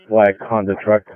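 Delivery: ordinary delivery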